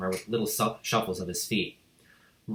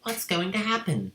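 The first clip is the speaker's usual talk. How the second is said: Lavender's voice; 10 semitones higher than usual. A narrator's reading.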